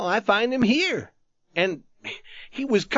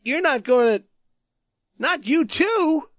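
high, loud, pleading; frightened plea; 11 semitones higher, 4 dB louder than usual. Acted. Youth begging the tattered man not to die, high and fearful. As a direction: dread breaking through the words. Youth fears friend dying too.

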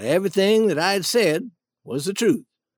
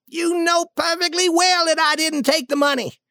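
high, sustained protest; acted exasperated anger; indignant denial; 10 semitones higher than usual. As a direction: insist on innocence crossly. Duke denies the theft.

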